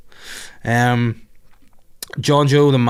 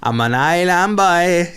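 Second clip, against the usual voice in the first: slow, higher sing-song Island Boy imitation; mock Island Boy; 7 semitones higher, 5 dB louder, slower than usual. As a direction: drag out island boy like the meme. Mocking the Island Boys song.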